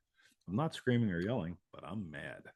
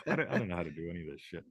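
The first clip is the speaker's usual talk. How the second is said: ordinary delivery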